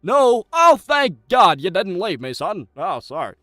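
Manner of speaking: shouting with mock relief at a returning viewer; overjoyed shout. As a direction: burst out in hammed-up relief. Greets returning viewer dramatically.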